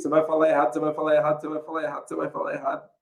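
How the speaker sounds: monotone repeated loop, fading away; hammering inner voice. Retold or imitated. Nagging thought in a shy boy's head, hammering repetition. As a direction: drum it in steadily, letting it sink. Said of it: "martelando aquele trem".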